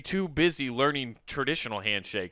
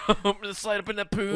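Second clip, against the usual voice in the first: fast, higher mock Canadian accent; hammed-up Canadian accent; 8 semitones higher, faster than usual. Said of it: "sounds like you're just some random Canadian".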